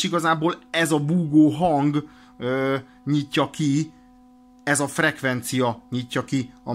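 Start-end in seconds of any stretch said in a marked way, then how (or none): none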